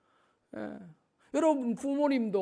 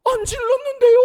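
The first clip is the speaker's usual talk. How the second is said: put-on very high, loud indignant child's yelp; kids' sudden denial; 13 dB louder than usual. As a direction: shriek the innocent denial like a caught kid. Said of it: "이러면 애들이 갑자기".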